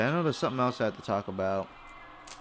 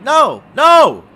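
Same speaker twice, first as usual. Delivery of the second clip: rising to a full shout; half-joking outburst, louder each time; exasperated yell; 13 semitones higher, 18 dB louder than usual. Real, not acted. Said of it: "Just stop it".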